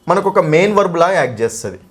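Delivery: usual speaking voice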